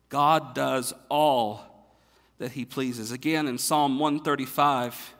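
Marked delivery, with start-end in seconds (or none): 0.1-3.1 slow, weighty, with all held long; stress on all. Shown as a demonstration. Pastor drilling the word "all" into his listeners, slow stress. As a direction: lean hard on "all", drawing it out. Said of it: "remember that word, all".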